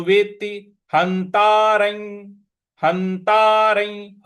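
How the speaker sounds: "हंतारं" chanted with a firm n and long taa; stressed half consonant. Shown as a demonstration. Teacher models the stress in "हंतारं", chanted. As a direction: lean on the n in "हंतारं", drawing out the ending. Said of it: "उस पर हमको आघात देना है".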